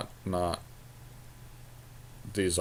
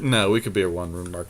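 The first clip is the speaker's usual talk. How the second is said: normal talking voice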